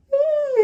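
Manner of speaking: a high, drawn-out sleepy sigh; hammed-up tired sigh; mock sleepy sigh. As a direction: sigh like you can barely stay awake. Sleepy Charisse mocked.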